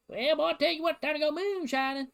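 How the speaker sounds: high-pitched mock Appalachian yokel voice; hammed-up Appalachian hillbilly twang. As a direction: ham up an Appalachian moonshiner accent. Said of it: "local yokels out in Appalachia".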